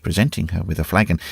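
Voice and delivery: usual speaking voice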